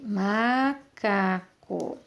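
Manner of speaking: "Macaco" drawn out slowly as ma, ca, co; syllable by syllable. Shown as a demonstration. Teacher writing macaco again, slow syllable-by-syllable dictation. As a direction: sound out "Macaco" softly and very slowly. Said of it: "Vou escrever de novo aqui".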